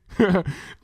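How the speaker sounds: high-pitched playful giggle; short high laugh in character. A narrator's reading. Twilight reacts to a playful nip.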